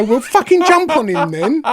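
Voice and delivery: high-pitched, exasperated urging; urgent silent plea. Retold or imitated. Doorman silently willing Paul to tackle the gunman, exasperated urging. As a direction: beg your mate to act, acted out. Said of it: "trying to telepathically go".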